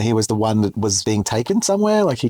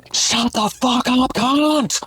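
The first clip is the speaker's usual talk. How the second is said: high, slow mock shout; retold yell; much slower than usual. Narrator yelling back at the pair through the door, slow mock shout. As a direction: imitate yelling back at them. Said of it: "At full volume".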